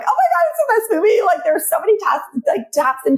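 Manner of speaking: high, fast, breathless gush; gushing excitement. Retold or imitated. Leaving the theater and raving to friends, excited high gush. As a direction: rave about the film with a rush of words.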